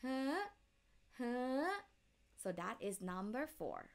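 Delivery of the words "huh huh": "Huh" starting mid, rapidly going up; questioning high tone. Shown as a demonstration. Teacher likening number four to English, "Huh" rising sharply. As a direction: flick "Huh" up at the end. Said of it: "end with high rapidly".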